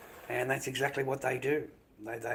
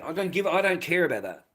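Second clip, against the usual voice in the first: raised voice; 5 semitones higher, 6 dB louder than usual. Real, not acted. Angry rant to stream chat.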